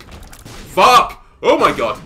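sudden loud curse at the game; shocked loud swear; real outburst. Real, not acted. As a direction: yelp of real frustration, pitch jumping up. Game mishap mid-story.

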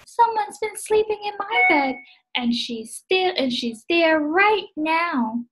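high little bear voice, slowing with alarm; tiny cub pointing at the girl still in his bed; Baby Bear. Acted. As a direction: squeak it out, then stretch the last words. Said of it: "Baby Bear said".

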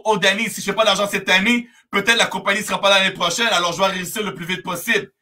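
rattled off quickly; hurried worrier, words tumbling out; anxious rushing. Acted. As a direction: rush it like an impatient beginner. Acted member rushing for money.

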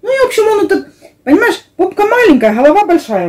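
high, lively telling; excited. Real, not acted. Animated retelling, high.

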